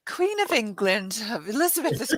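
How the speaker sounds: put-on British accent, playfully grand; mock British accent. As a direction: imitate a British narrator, hammed up. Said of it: "me in a British accent".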